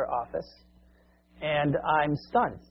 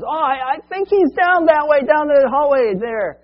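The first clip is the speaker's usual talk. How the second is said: high, loud call over the noise; yelling across the room; 11 semitones higher, 9 dB louder than usual. Retold or imitated. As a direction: shout it across a noisy lounge. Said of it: "one guy ... yells out for me".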